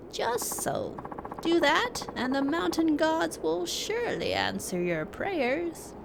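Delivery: put-on slow, lightly aged old man; a wise old hermit, unhurried. Reads old hermit NPC lines.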